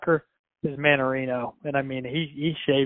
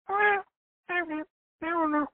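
much higher, put-on little voice; high-pitched, softer imitation of a reluctant answer; mock player's reply; 6 dB quieter than usual. Player dodging the barber's small talk, in a high mock voice.

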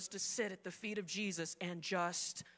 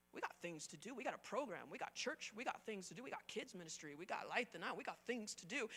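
soft, low grumbling under the breath; muttered list; 3 semitones lower, 13 dB quieter than usual. Retold or imitated. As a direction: grumble it to yourself. Martha listing busy duties.

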